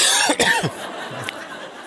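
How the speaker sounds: choking cough after swallowing wrong; coughing fit. Real, not acted. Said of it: "Ich hab mich verschluckt".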